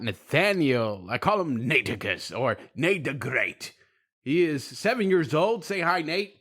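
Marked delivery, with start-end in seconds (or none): none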